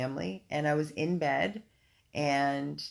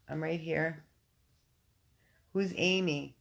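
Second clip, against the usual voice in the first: ordinary talk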